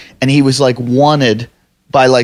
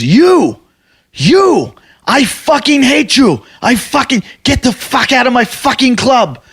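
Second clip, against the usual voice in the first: high-pitched mock yell of outrage; imitating angry owner; 8 semitones higher, slower than usual. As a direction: rage like the club owner, pitched way up. Acting out the angry club owner.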